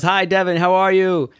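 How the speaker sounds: a hint of an eager dad saying hello; cheery greeting. Friend's father interrupting the Zoom call, lightly voiced cheery greeting.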